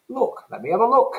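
high, quick chirpy voice; chirpy Londoner. Shown as a demonstration. Teacher playing a cheery Londoner for the class, chirpy and high. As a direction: sing it out cheerily. Translation, put-on voice.

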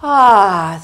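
loud open-throated ah sigh. Shown as a demonstration. Teacher demonstrating the down the slide sigh. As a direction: drop into a big down-the-slide sigh. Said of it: "I call that the down the slide sigh".

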